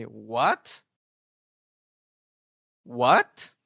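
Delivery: "What?" with a confused rising tune; puzzled "What? What?" sliding up. Shown as a demonstration. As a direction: rise on "What?" like asking in confusion. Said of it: "You're gonna say".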